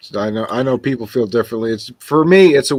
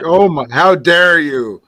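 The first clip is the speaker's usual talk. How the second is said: slow, drawn-out mock indignation; mock pompous outrage; 4 semitones higher, 4 dB louder, slower than usual. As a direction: imitate a pompous commenter, stretched out and a little higher. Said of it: "one of those pompous asshole comments".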